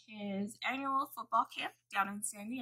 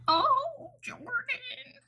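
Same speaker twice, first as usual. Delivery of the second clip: put-on high, quavery old-lady voice, much higher; wobbly old lady; 4 dB louder, much slower than usual. Future old self greeting Jordan, high wobbly old voice. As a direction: play herself as a frail old woman with a cane. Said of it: "Like, all wobbly with my cane".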